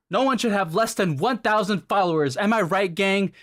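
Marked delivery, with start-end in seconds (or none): none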